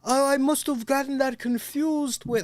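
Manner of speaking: high, loud mock African accent; friend's excuse. Friend excusing the mix-up, in a high, loud mock accent. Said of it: "he's like".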